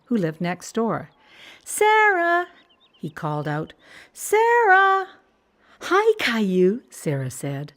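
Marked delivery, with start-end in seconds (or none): 1.6-2.5 put-on high, loud child's call; child calling his friend's name, much higher and louder. 4.1-5.1 put-on high, loud child's call; child calling his friend's name, much higher and louder. 5.8-6.8 put-on slow, louder girl greeting; Sarah greeting Caillou, slow and louder; girl's greeting. Girl voiced in story.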